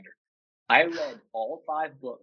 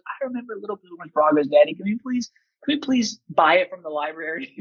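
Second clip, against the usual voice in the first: put-on higher, pleading child's voice; pleading little daughter; 5 semitones higher, 5 dB louder than usual. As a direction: imitate a little girl begging Daddy. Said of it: "she goes".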